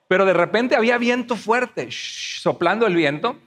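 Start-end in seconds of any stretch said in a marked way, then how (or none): 1.9-2.4 soft hushing whoosh; unvoiced rushing-air noise; imitated wind. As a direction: blow a soft "shh" like wind. Imitates strong wind blowing.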